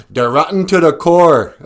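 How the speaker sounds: high, loud, mock African-accented declaration. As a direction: imitate a politician's African accent, forcefully. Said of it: "Where did that accent come from?".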